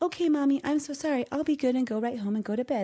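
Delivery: high, sweet imitation of an obedient kid; apologetic child. Imagined kids giving in to mom, sweetly apologetic. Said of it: "they're gonna be like".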